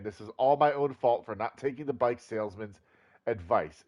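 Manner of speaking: normal talking voice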